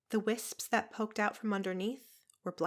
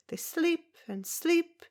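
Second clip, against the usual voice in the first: drawn-out sleep; 9 semitones higher, 4 dB louder, slower than usual. Acted. Zelda stretches the words.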